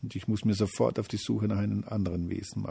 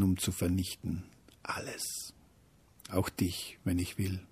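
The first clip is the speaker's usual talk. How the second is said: threat sinking into a whisper; menacing whisper; 6 dB quieter than usual. Acted. Hate threatens the little feeling, menacing whisper. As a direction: drop to an ominous hush.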